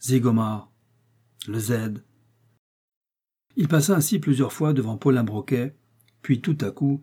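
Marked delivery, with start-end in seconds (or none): 0.0-2.0 grumbled to oneself, falling tune; grognements. A narrator's reading. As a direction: growl the name while brooding. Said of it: "reprit sa promenade et ses grognements". (grommelé pour soi-même)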